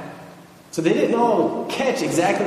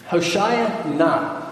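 ordinary talk, nothing marked

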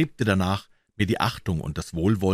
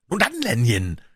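mild excited shout; great agitation; 3 semitones higher, 3 dB louder than usual. A narrator's reading. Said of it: "schrie er in größter Erregung".

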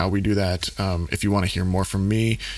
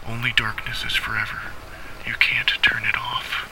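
sinister whisper. Acted.

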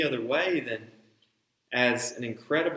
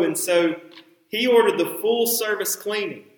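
ordinary talk

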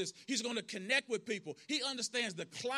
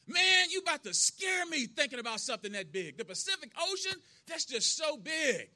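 louder, higher acted alarm; retold agitated alarm; 5 semitones higher, 4 dB louder than usual. Red tells Andy the ocean scares him, agitated. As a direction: act out being overwhelmed, voice raised and pitched up.

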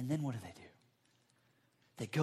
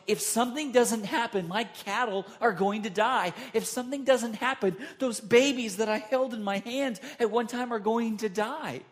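louder, higher anxious pleading; desperate inner cry; 6 semitones higher, 6 dB louder than usual. Retold or imitated. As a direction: voice a father's rising panic for his herds. Fathers voiced in desperate thought.

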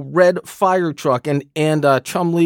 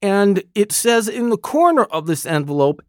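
normal talking voice

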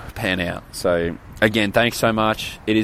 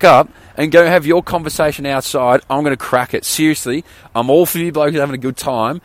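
raised, higher-pitched exasperated rant; retold telling-off; 5 semitones higher, 5 dB louder than usual. Sleepless guest to noisy hostel roommates, exasperated telling-off. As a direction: tell them off, slowing hard on the final plea.

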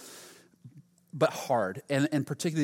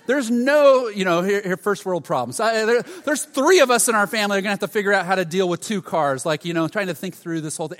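loud, high complaining rant; hammed-up self-pity, pleading with the world; louder, higher whiny moan about the car; whining; 6 semitones higher, 9 dB louder than usual. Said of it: "whining and feeling bad for myself".

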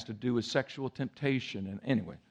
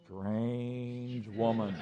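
very slow, soft, drawn-out; the Lord's voice warning the pastor, drawn-out and soft; 5 dB quieter, much slower than usual. Retold or imitated. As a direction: stretch it out like a divine warning. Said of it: "hear the voice of the Lord saying".

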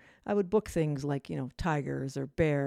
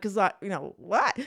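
usual speaking voice

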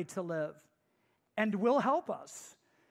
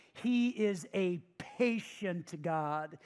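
ordinary talk, nothing marked

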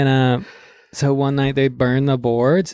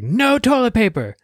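strict house manager; 11 semitones higher than usual. Retold or imitated. Rick bossing the kids, high stern order. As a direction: lay down the house rule. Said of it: "and he's like".

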